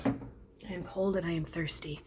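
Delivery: breathy whisper to herself. Real, not acted. Just out of trance, she whispers to herself. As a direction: whisper that she's cold and thirsty.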